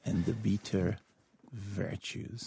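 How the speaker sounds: soft, almost whispered prompt; softly feed him his line; quiet prompt. Real, not acted.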